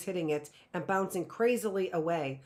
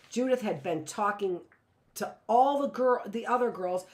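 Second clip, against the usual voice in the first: plain reading voice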